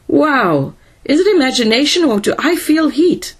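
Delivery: alarmed exclamation rising into a question; startled. A narrator's reading. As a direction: react to a sudden blast of heat, voice jumping up. Donovan startled by sudden heat.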